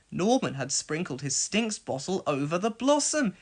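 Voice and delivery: ordinary delivery, nothing marked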